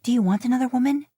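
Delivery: a touch of a jealous wife; Mila's voice. A narrator's reading. Mila confronts Dean.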